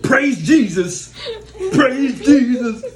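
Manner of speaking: high, loud, slow drawn-out praise. Retold or imitated. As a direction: stretch it out in mock piety.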